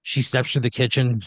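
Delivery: ordinary talk, nothing marked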